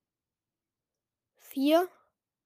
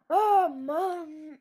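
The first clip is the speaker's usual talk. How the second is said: laughing groan; 6 semitones higher than usual. Real, not acted. Frustrated after losing.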